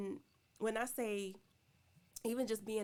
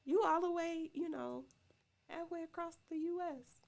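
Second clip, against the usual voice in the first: incredulous scoff; 8 semitones higher than usual. Retold or imitated. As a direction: scoff at the idea in disbelief. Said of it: "that was my response".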